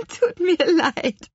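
high, drawn-out giggled apology; laughing. A narrator's reading. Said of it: "lachte die Apfelhexe".

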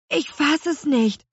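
put-on high, astonished boy; can't believe his eyes; amazed cry. A narrator's reading. Louis exclaims at the witch in the sky, amazed. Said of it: "rief Louis".

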